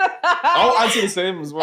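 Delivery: high laughing reply. Real, not acted. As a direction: laugh as you say it. Laughing at awkward question.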